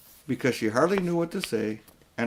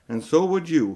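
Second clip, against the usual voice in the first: miserable loud; 5 semitones higher, 5 dB louder than usual. A narrator's reading. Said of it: "so miserable that he didn't care".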